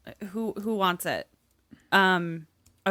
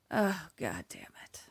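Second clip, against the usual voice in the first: low, hushed, defeated groan; resigned curse; 6 semitones lower, 9 dB quieter than usual. Real, not acted. Player who lost the bet, quietly defeated. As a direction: let the curse sink quietly.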